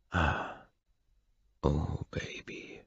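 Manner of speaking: a reverent slow murmur; awed whisper. A narrator's reading. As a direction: breathe it out in wonder. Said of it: "flüstere ich ehrfürchtig".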